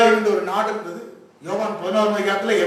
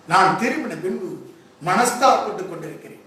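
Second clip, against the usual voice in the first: ordinary talk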